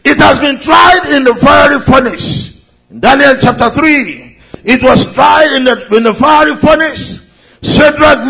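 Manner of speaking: rising fervent sermon voice; preaching. Acted. Preaching at full force.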